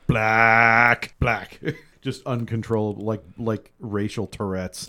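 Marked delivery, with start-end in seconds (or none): 0.1-1.6 blurted anchorman outburst, middle one shouted; Ron Burgundy. Retold or imitated. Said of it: "Ron Burgundy in Anchorman two".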